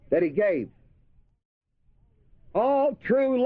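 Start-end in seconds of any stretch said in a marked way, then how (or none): 0.1-0.7 gave said firm and falling; stress on gave. Shown as a demonstration. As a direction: give gave the secondary weight. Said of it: "The secondary emphasis is that he gave".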